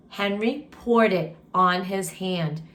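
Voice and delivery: ordinary delivery, nothing marked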